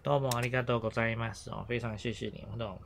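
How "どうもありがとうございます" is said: どうもありがとうございます run off quickly as one phrase; quick polite thanks. Shown as a demonstration. Teacher showing students the polite thank-you, quickly. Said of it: "后面再加ございます".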